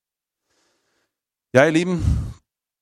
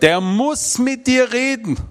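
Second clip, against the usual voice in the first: high, slow insistent quote; pastor's wife insists her husband must talk; pastor's wife insisting; 12 semitones higher, 3 dB louder, slower than usual. Retold or imitated. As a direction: repeat her demand emphatically. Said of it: "Er sagt".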